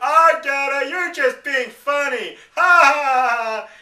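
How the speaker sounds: high, loud scoffing laughter; mock disbelieving laugh. Imitating people who think he's lying, in a high, loud scoffing laugh. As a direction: play someone who thinks it's a joke. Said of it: "people think I'm lying".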